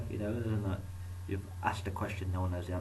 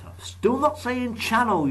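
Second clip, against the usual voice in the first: much higher, louder, slow squeaky aside; mock squeal; 8 dB louder, slower than usual. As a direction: squeak it out in a silly high voice. Jokey high squeal.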